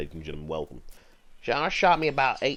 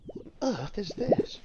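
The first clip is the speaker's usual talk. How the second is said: amazed; 10 semitones higher than usual. Real, not acted. Strange bird call playing.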